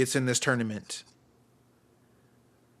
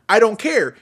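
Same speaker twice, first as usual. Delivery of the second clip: ordinary delivery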